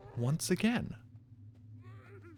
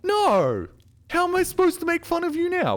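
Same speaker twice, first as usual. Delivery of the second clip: much higher and louder, yelling in disbelief; excited amazed shouting; 5 dB louder than usual. Real, not acted. Streamer amazed the bad map dev improved, shouting. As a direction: shout in stunned delight at the map.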